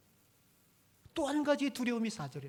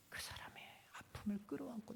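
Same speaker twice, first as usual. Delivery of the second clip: whispered prayer; 3 semitones higher, 11 dB quieter than usual. Pretend. Intercessory prayer voiced for another.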